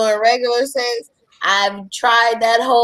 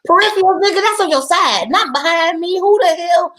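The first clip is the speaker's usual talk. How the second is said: worked-up, incredulous comeback; indignant outburst; 6 semitones higher than usual. Real, not acted. Heated comeback to the peripherals remark.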